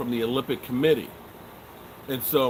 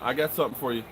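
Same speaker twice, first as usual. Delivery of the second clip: ordinary delivery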